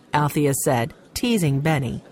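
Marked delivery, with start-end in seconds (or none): none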